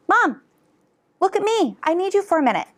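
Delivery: put-on high pleading child voice; child's plea. Mother voicing her baby begging for attention, high and pleading. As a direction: plead like a little kid wanting mom. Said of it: "because they're saying".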